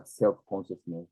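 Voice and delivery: normal talking voice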